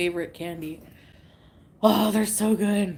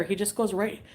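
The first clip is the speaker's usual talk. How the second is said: ordinary talk, nothing marked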